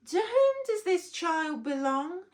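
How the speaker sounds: high, loud regal queen voice; a grand queen bee asks her hall, loud and high; Queen Bee, expectant. A narrator's reading. Queen bee addressing the bee audience, loud and expectant. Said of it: "asked Queen Bee expectantly".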